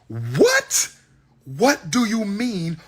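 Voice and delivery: high, slow, drawn-out what do you mean; stunned disbelief. Retold or imitated. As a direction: stretch it out in stunned confusion. Stunned reaction to news.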